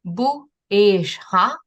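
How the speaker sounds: slow, careful "Bu és ha" with each syllable clear; Aui question phrase. Shown as a demonstration. Teacher sounding out an Aui question for a listener, slow and careful. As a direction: read "Bu és ha" slowly, as a demo for a learner. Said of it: "questions are made with the questions symbol".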